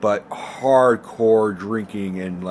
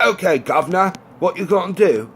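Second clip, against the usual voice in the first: forced, high-pitched fake Cockney; bad Cockney accent; 8 semitones higher, 4 dB louder than usual. Reviewer mocking an actor's faked accent, bad forced Cockney. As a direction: put on an overdone Cockney accent, pitched well up. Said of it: "really bad impression of a Cockney accent".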